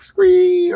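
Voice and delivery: high, loud, drawn-out screech; screech sound effect. Shown as a demonstration. Showing the comic's sound effect, high screech. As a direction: imitate a flying screamer's long shriek. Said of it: "like, a little sound effect".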